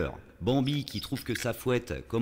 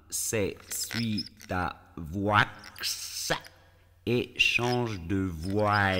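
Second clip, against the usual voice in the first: spelling out the pun very slowly; slow explaining; much slower than usual. Shown as a demonstration. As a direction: drag every word out for the slow ones. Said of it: "pour ceux qui n'auraient pas saisi".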